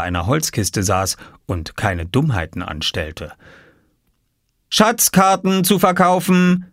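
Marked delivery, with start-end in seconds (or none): 4.7-6.7 higher, slow call like a little vendor. A narrator's reading. Oskar calling to passers-by, selling maps. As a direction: cry out the wares slowly. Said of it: "rief Oskar".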